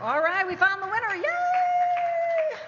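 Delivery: high, loud happy cheer; excited cheer. Real, not acted. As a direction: whoop for the winner. Cheering when the winner is found.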